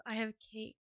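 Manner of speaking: ordinary talk, nothing marked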